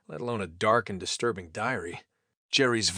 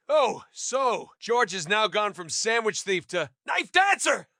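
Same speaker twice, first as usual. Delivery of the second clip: sarcastic; 9 semitones higher, slower than usual. A narrator's reading.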